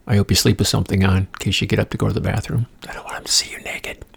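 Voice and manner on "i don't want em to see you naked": hushed unvoiced aside; whisper. Joking aside to listeners, whispered. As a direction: whisper it like a secret.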